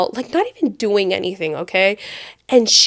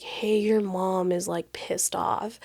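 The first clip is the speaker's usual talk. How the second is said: softer, slow hushed voice; hushed warning; 2 semitones lower, 11 dB quieter, slower than usual. Retold or imitated. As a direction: quietly wake him with bad news. Waking boyfriend quietly.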